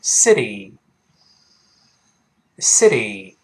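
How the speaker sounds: slow "city" with the T softened to a D; "city", flapped middle T, drawn-out EE; voiced T. Shown as a demonstration. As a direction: model "city" as SIDDY, twice with pauses. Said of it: "中间那个t再浊化成的".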